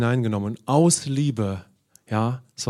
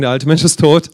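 louder call on tot, led for the congregation to echo; loud proclamation; 3 semitones higher, 8 dB louder than usual. Shown as a demonstration. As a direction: proclaim "der alte Mensch ist tot" loudly so the room joins in. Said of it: "Wenn ihr möchtet, können wir sagen".

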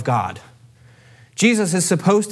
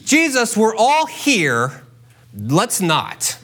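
higher, slow, laughing protest; practical objection; 6 semitones higher, much slower than usual. Acted. Martha balks at opening the tomb, laughing protest. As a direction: wave him off with a chuckle. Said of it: "She's real practical".

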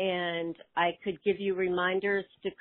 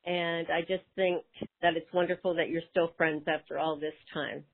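usual speaking voice

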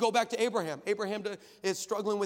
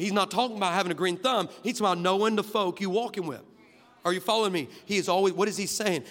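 louder, driving sermon delivery; preaching at full force; 8 dB louder than usual. Acted. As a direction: preach it out to the whole room.

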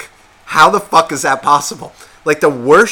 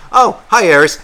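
mock cheery greeting; 6 semitones higher, 7 dB louder than usual. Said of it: "just be like".

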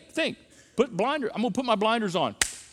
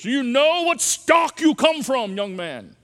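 put-on stuffy old-timer; 4 semitones higher, 5 dB louder, slower than usual. Mocking old-fashioned family pride.

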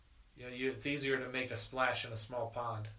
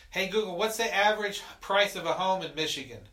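raised voice to a smart speaker; loud command; 6 semitones higher, 6 dB louder than usual. Real, not acted. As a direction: call out clearly to the device.

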